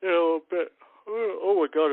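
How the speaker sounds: a yawn swallowing the words. Real, not acted. Worn-out host yawning mid-thought to the viewers. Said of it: "when I go to yawn".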